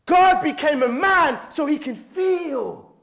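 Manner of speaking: high, loud preacher's cry; passionate screaming. Real, not acted. Preacher driving home why God became man, screaming passionately. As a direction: belt it out with conviction. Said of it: "Why is he screaming?".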